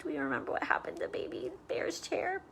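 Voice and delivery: put-on quick whispered question to the kids; hushed question. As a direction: whisper it like a shared secret. Teacher asks children in hushed aside.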